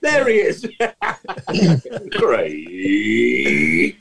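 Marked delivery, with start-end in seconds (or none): 0.7-2.1 long embarrassed laugh. Real, not acted. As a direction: laugh at having forgotten him. Laughs at forgotten guest.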